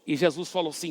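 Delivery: normal talking voice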